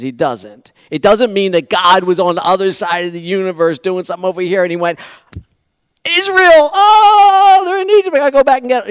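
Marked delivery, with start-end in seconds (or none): 6.1-8.9 high, loud, rushed sudden remembering; mock startled God. Preacher mocks a forgetful God who suddenly remembers Israel, high and hurried. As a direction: play God jolting awake to a forgotten chore, hammed up.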